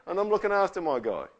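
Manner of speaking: acted firm warning; protective God. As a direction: stake a claim over a friend. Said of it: "He's going".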